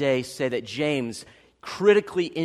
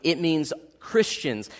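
ordinary talk

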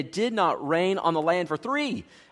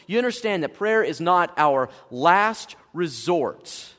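ordinary talk, nothing marked

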